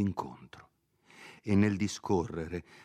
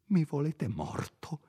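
hushed, terrified plea; frightened whisper; 8 semitones higher than usual. Acted. Cornered priest begs Renzo in a frightened whisper. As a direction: whisper it, scared for his life.

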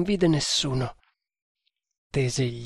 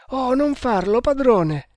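pleading cry; 10 semitones higher, 4 dB louder than usual. Acted. Servant begs master not to go.

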